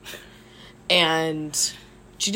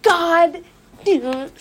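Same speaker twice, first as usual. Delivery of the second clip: mom's trailing-off curse; 8 semitones higher, slower than usual. Retold or imitated. Impersonating mom cursing, trailing off. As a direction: start a curse and rein it in. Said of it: "She'll be like".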